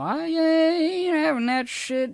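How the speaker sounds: slow, high, loud mock JonTron accent; JonTron's accent. Imitating JonTron's accent to show his comedy, slow high mock accent. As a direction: drawl it out in a put-on JonTron accent. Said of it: "he's got an accent".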